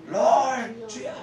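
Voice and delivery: high strained call out in prayer; desperate cry to God. Shown as a demonstration. Preacher shows how he called on God, desperate cry. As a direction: demo of crying out the Lord's name. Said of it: "I used to call Trio".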